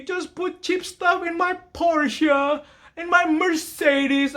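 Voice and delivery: high, slow whiny pleading; mock begging customer. Customer begging mechanic for cheap stuff, high slow whine. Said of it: "people are begging you".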